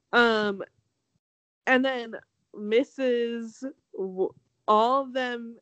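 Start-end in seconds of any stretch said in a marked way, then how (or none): none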